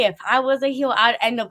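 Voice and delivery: ordinary talk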